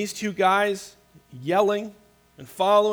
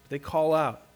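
ordinary talk, nothing marked

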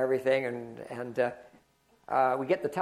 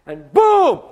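a sudden shouted boom, much louder; 7 semitones higher, 11 dB louder than usual. Retold or imitated. Pastor recreating the tire blowout for the congregation, loud shouted boom. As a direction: bark out the bang like the blowout itself.

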